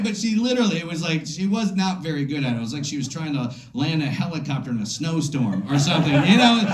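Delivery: normal talking voice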